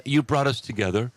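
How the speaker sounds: ordinary delivery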